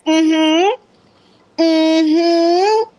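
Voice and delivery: high, long rising mm-hmm; approving mm-hmm. Real, not acted. Approving Jax's new braids, high rising hum. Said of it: "approving the braids".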